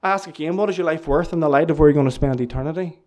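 ordinary talk, nothing marked

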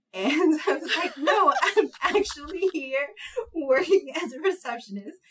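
high, loud retelling through laughter; laughing protest. Retold or imitated. Teenage receptionist explaining herself to big time partner, laughing. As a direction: crack up while imitating your flustered younger self.

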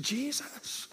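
hushed, rising whisper; whispered plea. Acted. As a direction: breathe his name timidly. Man pleads softly.

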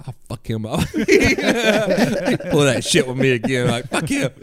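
high, laughing grumble; laughing mock threat. Grumbling about the officer, half laughing. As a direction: threaten him while laughing. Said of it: "And I'm like".

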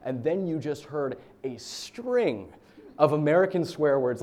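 ordinary talk

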